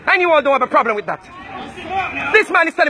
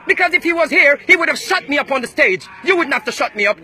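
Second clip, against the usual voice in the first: ordinary delivery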